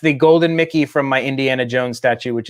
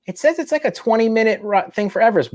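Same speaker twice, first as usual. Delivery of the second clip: wife; 9 semitones higher, faster than usual. Retold or imitated. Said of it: "the wife goes".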